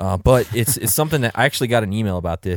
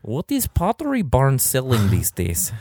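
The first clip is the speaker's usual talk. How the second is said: put-on Russian accent; 4 semitones higher, slower than usual. Russian accent bit continues.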